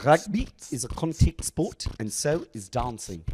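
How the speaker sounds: light South African accent; a hint of a South African accent. Shown as a demonstration. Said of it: "my South African accent, uh, goes like this".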